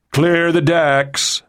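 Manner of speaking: high, slow call, restrained in volume; shouted. A narrator's reading. Nixon warns the deck crew, shouted. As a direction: call it out, stretching each word. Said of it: "Nixon shouted".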